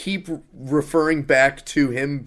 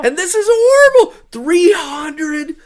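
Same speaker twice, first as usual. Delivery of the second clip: exasperated; 13 semitones higher, 5 dB louder than usual. Real, not acted. Ranting about a bad movie.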